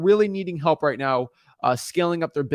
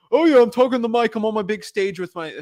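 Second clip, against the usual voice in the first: guru impression; 6 semitones higher than usual. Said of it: "all the gurus".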